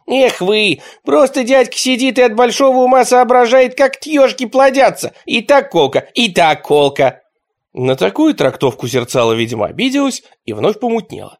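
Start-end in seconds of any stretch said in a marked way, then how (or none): 0.1-7.2 put-on high, loud boyish teasing; sing-song mockery of a know-it-all kid; cheeky kid voice. A narrator's reading. Nichevok mocking the grown-ups, high and cheeky.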